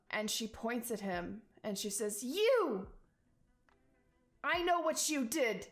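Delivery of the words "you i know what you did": high, raw accusing voice, near tears; a panicked mother pointing at the killer; shrill cry of grief and blame; frightened rabbit woman. Acted. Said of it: "very scared".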